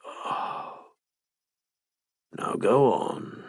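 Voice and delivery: slow hushed sigh then urging; a soft slow aroused whisper. Acted. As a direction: exhale and urge him on slowly. Aroused urging in love scene.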